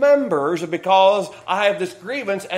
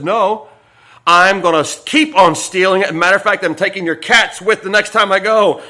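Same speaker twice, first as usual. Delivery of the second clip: louder, cocky refusal; defiant refusal; 8 dB louder than usual. Retold or imitated. Pastor Phil refusing the complaint, cocky and loud. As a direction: dare him to stop you. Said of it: "Pastor Phil says".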